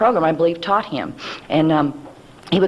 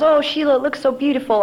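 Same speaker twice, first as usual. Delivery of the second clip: gushing aunt; 9 semitones higher than usual. Retold or imitated. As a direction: gush over the niece's new hair. Aunt gushing over hairdo.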